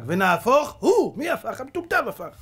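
high, rising, loud punchline; gleeful preaching. Real, not acted. Preacher revealing who flipped the dice, high gleeful exclamation. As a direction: build the reveal with loud relish.

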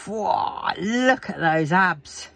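slow, drawn-out admiring gasp; admiring whoa. Real, not acted. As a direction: savour each word in awe.

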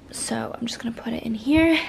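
soft unvoiced whisper; quiet whispered aside. Real, not acted. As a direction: murmur it under your breath. Whispering while packing devices.

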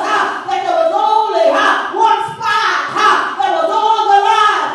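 rhythmic chanted preaching, a huh after each phrase; preaching whoop. Acted. Preacher whoops the battle story to the congregation, chanting with huh.

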